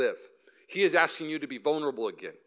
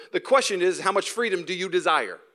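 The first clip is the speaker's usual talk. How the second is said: normal talking voice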